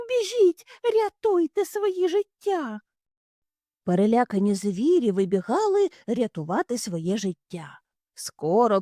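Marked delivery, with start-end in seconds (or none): 0.1-2.8 very high, urgent call; urgent shout. Acted. Hares shout at freed animals to run. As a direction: urge everyone to run.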